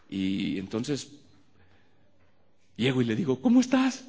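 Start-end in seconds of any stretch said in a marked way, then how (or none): 3.4-4.0 high bright overly cheerful how-are-you; awkward cheery greeting. Retold or imitated. Pastor greeting a dying woman, awkwardly cheerful. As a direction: greet her far too cheerfully. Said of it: "A quién se le ocurre hacer esa pregunta".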